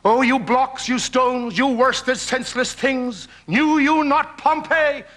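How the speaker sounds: theatrical Shakespearean oration; reciting Shakespeare. Acted. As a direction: declaim it as slowing stage verse. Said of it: "I'm doing the lines".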